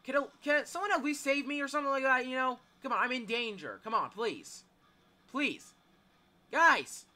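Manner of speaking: loud, high begging; desperate pleading. Real, not acted. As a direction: beg the team to save you. Pleads with teammates to save them.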